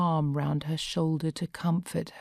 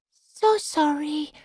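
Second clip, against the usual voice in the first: higher, slow so sorry; apologetic; 11 semitones higher, slower than usual. A narrator's reading. Corrie apologises again to Sarah, pitched higher. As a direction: apologise humbly.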